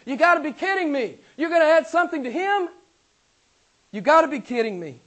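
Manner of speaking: loud, high-pitched disbelief; incredulous outburst. Acted. Preacher voicing Paul to those adding to Christ, loudly incredulous. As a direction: throw it out as an appalled challenge.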